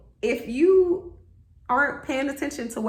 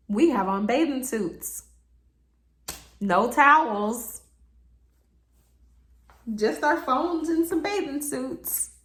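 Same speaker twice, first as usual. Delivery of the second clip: ordinary talk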